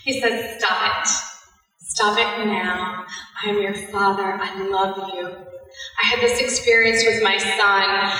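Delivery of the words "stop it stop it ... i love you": soft, low, gently firm; tender fatherly reassurance. Acted. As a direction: hush them gently like a loving father. Voicing God as loving father.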